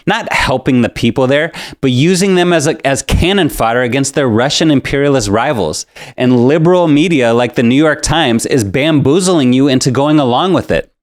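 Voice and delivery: ordinary delivery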